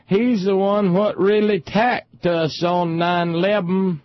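high, slow, drawn-out yokel caricature; mock a ranting wing nut in a high put-on voice, stretching every word; hammed-up ignorant partisan hollering; screaming wing nut. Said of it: "wing nuts would scream".

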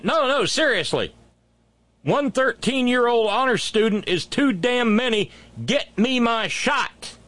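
high-pitched, emphatic demand; insistent crowd voice. Pretend. Imagined people lining up for vaccines, insistent.

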